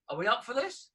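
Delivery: normal talking voice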